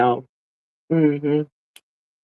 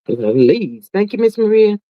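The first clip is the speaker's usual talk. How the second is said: ordinary talk, nothing marked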